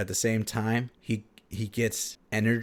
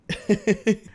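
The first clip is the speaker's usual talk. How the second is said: amused laugh; 12 semitones higher, 5 dB louder than usual. Real, not acted. Laughs at the story's end.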